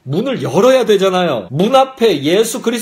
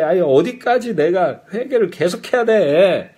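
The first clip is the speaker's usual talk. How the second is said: grumbling, fed-up protest; mock whining; 2 semitones higher than usual. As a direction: play the reluctant churchgoer brushing off a nag. Acts out people refusing to repent.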